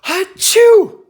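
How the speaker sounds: loud, high pretend sneeze; big hammed-up achoo; mock sneeze. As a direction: burst out with a fake ha-chew. Host mimics the story's sneeze.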